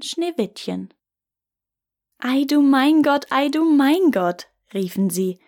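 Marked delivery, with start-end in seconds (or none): 2.2-4.5 amazed exclaiming. A narrator's reading. Dwarves marvel at sleeping child.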